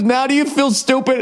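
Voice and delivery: imitating the driver's snide gotcha; smug taunt. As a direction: gloat like the smug rich driver. Retold quarrel, driver's taunt repeated.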